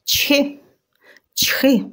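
high sharp double sneeze; loud sneeze. A narrator's reading. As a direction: sneeze twice suddenly in the silence. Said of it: "раптом пролунало".

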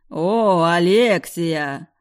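mocking. Villain greets mockingly.

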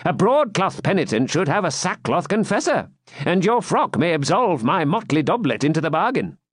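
high, lilting jester's voice; quipping jester. Acted. Jester answering the hermit's excuse, quipping. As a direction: deliver the joke high, as a jester. Wamba jokes in reply.

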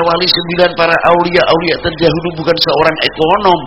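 high, challenging sermon voice; forceful preaching. Acted. Preacher challenging listeners about the Wali Songo's struggle, forceful preaching.

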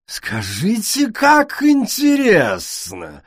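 high, slow, mock-amazed sneer; scornful snort. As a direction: fake fascination, dripping scorn. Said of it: "королева фыркнула".

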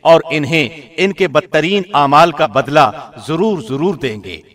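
ordinary delivery

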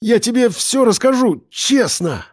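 high, desperate promise; pleading. A narrator's reading. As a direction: swear it in a panicky high voice. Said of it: "взмолился он".

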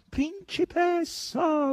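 boar's high, drawn-out whine; whining howl. A narrator's reading. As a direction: wail the title like a pleading pig. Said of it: "urlò il verro con voce piagnucolosa". (urlo piagnucoloso e acuto)

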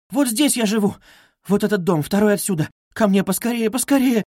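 high, urgent directions; pleading. A narrator's reading. Sonya begging bearers toward her home, pleading. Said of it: "умоляла Соня".

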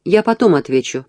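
ordinary delivery

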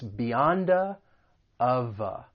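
BEYOND A as "beyon-duh", OF A as "o-vuh"; schwa linking. Shown as a demonstration. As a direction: isolate each linked pair, A as uh. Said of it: "link some consonants".

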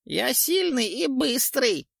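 high, slow bragging squeak; boastful ghoul. A narrator's reading. Ghoul boasts to the witcher, high and smug. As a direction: brag through crooked teeth. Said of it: "хвастливо оскалился".

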